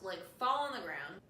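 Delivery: usual speaking voice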